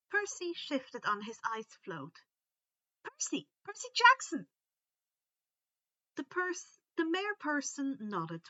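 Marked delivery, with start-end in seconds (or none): 3.0-4.5 high, loud and eager, blurting out his name; a boy bursting to introduce himself; eager shout. Acted. Percy eagerly tells his name.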